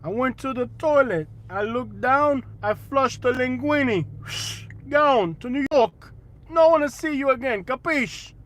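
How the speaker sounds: hammed-up Italian-American New York accent. Shown as a demonstration. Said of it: "I'm not in a New Yorker mood today".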